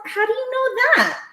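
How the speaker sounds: high, incredulous retold question; startled question. Man startled that she knows the brand, incredulous.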